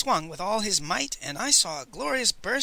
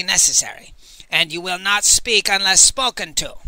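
loud, higher, commanding tyrant; tyrannical grandmother; 4 semitones higher, 5 dB louder than usual. A narrator's reading. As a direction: lay down the law like a warlord matriarch. Monstrous grandmother dictating terms.

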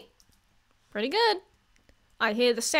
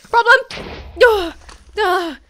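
exasperated; 8 semitones higher, 9 dB louder than usual. Real, not acted. Exasperated at mob.

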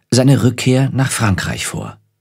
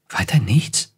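mildly surprised, quiet question; a touch of surprise, softly put; 4 dB quieter, slower than usual. A narrator's reading. Ophelia questions her brother's advice, mildly surprised. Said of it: "fragte überrascht".

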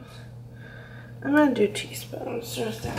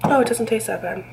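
ordinary talk

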